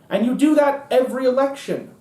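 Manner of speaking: normal talking voice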